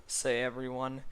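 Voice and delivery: plain reading voice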